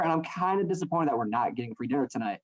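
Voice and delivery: usual speaking voice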